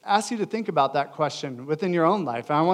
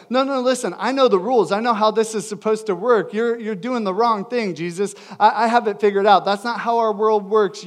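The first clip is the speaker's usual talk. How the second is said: higher-pitched, insistent correction; Peter rebuking; 4 semitones higher, 4 dB louder than usual. Acted. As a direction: set him straight, pressing each point. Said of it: "this example of Peter rebuking Jesus".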